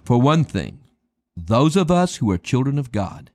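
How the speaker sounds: usual speaking voice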